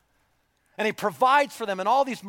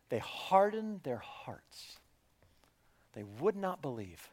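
slow, much softer solemn whisper; 3 semitones lower, 10 dB quieter than usual. Acted. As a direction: let the verdict fall heavy and hushed. Solemn scripture quote hushed.

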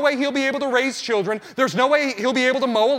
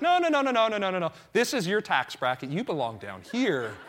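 teasing friend; 6 semitones lower, 7 dB quieter than usual. Retold or imitated. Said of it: "steers me away ... and is like".

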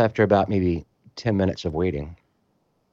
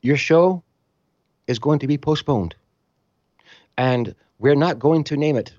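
Greek official; 6 semitones higher than usual. Retold or imitated. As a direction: announce it like a stern foreign official. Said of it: "they go".

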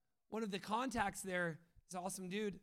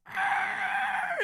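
very high, loud mock roar; imitated growl shout; 12 dB louder than usual. The bus driver swerving round, loud mock roar. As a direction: let out a wild cartoonish roar. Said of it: "And he goes".

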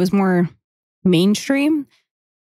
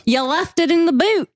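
higher, hammed-up Australian accent; mock Australian accent; 6 semitones higher than usual. Joking friends trading lines in a mock Australian accent. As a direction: toss it off like a cheeky Aussie bloke. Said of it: "I love Australian accents".

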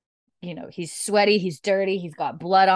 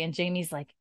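ordinary delivery

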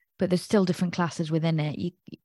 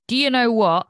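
louder, higher sudden snap; 5 semitones higher, 5 dB louder than usual. Retold or imitated. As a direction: snap like a charging bull. Bull snapping.

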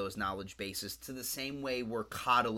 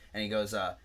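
ordinary talk

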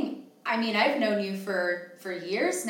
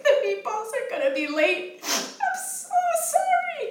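imitating her younger self crying, much higher and slowed; mock crying apology; 7 dB louder than usual. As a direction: wail the apology like a teary young employee. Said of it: "Just, like, crying".

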